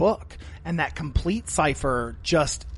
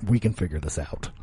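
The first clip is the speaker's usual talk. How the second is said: deep mock voice; 12 semitones lower than usual. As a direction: drop way down like a solemn movie voice. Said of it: "I was like".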